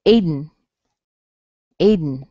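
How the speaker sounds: "Aiden" with D straight into N; no vowel between D and N in "Aiden"; nasal release demo. Shown as a demonstration. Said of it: "and then N".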